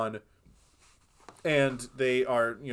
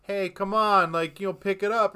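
polite nudge; 6 semitones higher, 5 dB louder than usual. Retold or imitated. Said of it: "he's, like, really polite about it".

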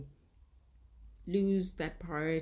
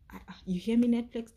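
calling out; 5 semitones higher, 7 dB louder, faster than usual. Real, not acted. Calling out to Netflix.

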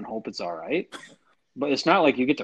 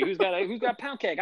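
auctioneer; 9 semitones higher than usual. Retold or imitated. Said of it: "like an auction".

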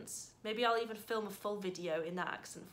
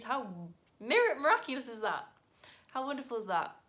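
gushing delight; 4 semitones higher, 9 dB louder than usual. Real, not acted. Gushing gratitude to viewers.

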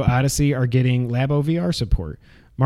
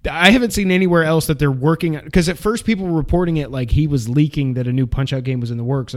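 ordinary talk

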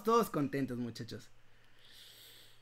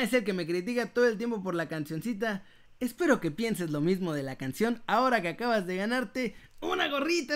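ordinary delivery

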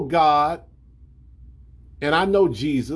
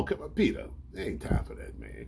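calming hush; 10 dB quieter than usual. Acted. Jesus calms Peter.

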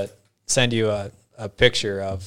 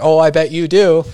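louder, higher insisting salesman; pushy salesman; 6 semitones higher, 7 dB louder than usual. Retold or imitated. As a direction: lean in like a pushy seller.

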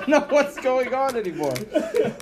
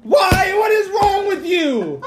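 outraged shout; 10 semitones higher, 5 dB louder, slower than usual. Real, not acted. Host outraged friend picked [name], loud disbelief.

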